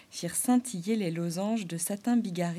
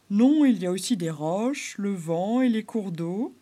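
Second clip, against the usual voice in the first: louder, measured beaver voice; beaver correcting; 6 dB louder than usual. Acted. Beaver correcting the clown about nature. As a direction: correct him gently, slowing down.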